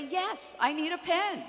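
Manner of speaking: high, bright-eyed eager yes; hammed-up excitement, voice jumping up; excited. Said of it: "And I excitedly say".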